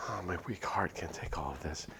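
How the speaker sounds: barely voiced, faint; weak whisper. Anita overwhelmed by her pounding heart, whispering. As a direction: whisper it out of breath. Panic attack.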